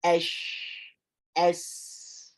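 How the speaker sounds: voiceless "esh" and "S", air flowing after each; whispered letters. Shown as a demonstration. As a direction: breathe out through "esh" and "S".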